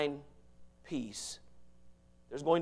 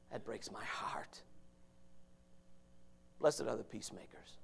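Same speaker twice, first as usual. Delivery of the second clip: hushed whisper; 11 dB quieter than usual. Real, not acted. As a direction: drop to a whisper to pull them close. Preacher drops to whisper.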